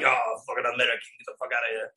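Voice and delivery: low, grumbled brush-off; low, soft, scornful grumble; dismissive French local. Retold or imitated. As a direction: imitate a contemptuous local waving someone away. Voicing dismissive French people.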